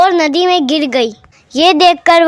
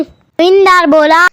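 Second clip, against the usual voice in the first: ordinary delivery, nothing marked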